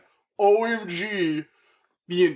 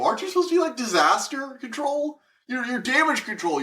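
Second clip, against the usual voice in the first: much higher, disbelieving rant; incredulous; 8 semitones higher than usual. Real, not acted. Ranting at the cops, incredulous and high. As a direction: demand an answer from the cops. Outraged at the police.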